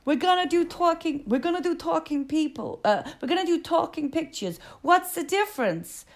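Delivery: a hint of an old Hollywood mogul; film producer voice. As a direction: play the pompous producer announcing talkies. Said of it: "Monumental Pictures goes".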